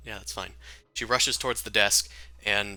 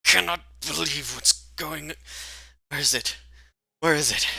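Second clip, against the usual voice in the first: raised pitch, agitated self-talk; flustered searching; 7 semitones higher than usual. Acted. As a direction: hunt frantically while talking to yourself. Flustered character rummaging for her things.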